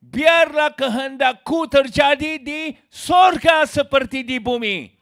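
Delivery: slow shouted demand, turning the prayer around; mock religious demand. Shown as a demonstration. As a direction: boom it out like a self-willed religious man. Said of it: "Tetapi agamawi".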